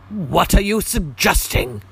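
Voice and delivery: loud, slow, high angry challenge. Acted. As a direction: bristle and demand it angrily. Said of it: "asked Thornton angrily".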